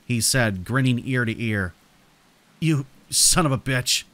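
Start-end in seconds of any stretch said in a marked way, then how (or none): 2.6-4.0 tight, contained anger; seething. A narrator's reading. Trapped narrator turning on their tormentor, seething. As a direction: spit it out with restrained fury. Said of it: "I seethed".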